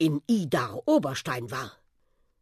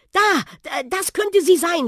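much higher, louder excited cry; excited shout; 14 semitones higher, 6 dB louder than usual. A narrator's reading. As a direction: blurt it out, spotting her at last. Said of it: "schrie Matteo".

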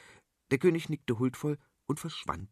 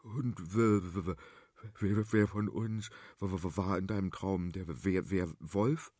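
low, soft, stuttering in fear; timid boy stammering quietly; frightened stammer; 5 semitones lower, 5 dB quieter than usual. Acted. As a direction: stumble over every word, scared stiff. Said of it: "riss erschrocken die Augen auf".

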